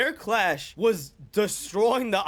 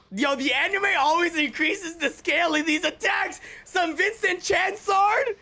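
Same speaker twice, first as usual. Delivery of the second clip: hype yelling; 8 semitones higher, slower than usual. Real, not acted. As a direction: keep yelling in awe at the screen. Yelling at the attack.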